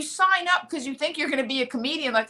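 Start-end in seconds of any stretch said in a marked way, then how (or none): none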